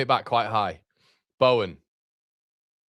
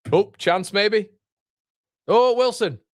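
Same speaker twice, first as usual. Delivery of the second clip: sudden high, louder cry at a chance; excited yelp; 12 semitones higher, 5 dB louder, slower than usual. Real, not acted. Fan watching live, yelping at a sudden chance, excited. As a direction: jump up as the striker breaks through.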